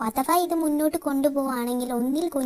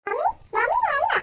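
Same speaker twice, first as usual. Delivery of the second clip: put-on much higher little boy calling; child voice; 12 semitones higher than usual. Son asking his dad whether mummy is back, high child voice. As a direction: pipe it up like a small kid.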